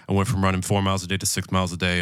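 rushed, mumbled mock spiel; fast mumbled pitch. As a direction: rattle it off fast like a memorized sales pitch. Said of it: "he said it so fast and mumbled it".

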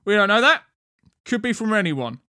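high, loud, dismissive guard; grunting guard. A narrator's reading. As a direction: object loudly like a stubborn sentry. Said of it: "the first guard grunted".

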